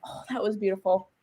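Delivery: hushed admiration. Retold or imitated. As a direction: drop the volume for a retold awed reaction. Retold awed reaction to the moment.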